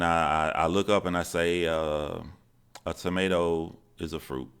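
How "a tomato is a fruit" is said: normal talking voice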